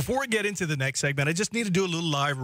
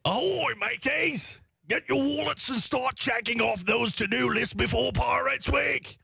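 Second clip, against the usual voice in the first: high, slow, hammed-up pirate shout; 10 semitones higher, slower than usual. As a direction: bellow it like a cartoon pirate captain. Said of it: "gotta read it in a pirate voice".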